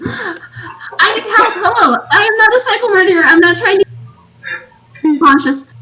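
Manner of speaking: high-pitched, laughing protest; pleading in character. Acted. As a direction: plead innocence to a fleeing man. Player calls to fleeing guard in character.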